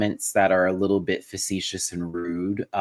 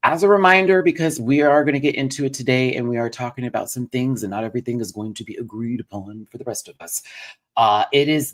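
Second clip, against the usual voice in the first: upbeat, higher announcer read of disclaimer; show intro announcer; 5 semitones higher, 5 dB louder than usual. Acted. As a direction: rattle off the show disclaimer brightly. Reads his show disclaimer.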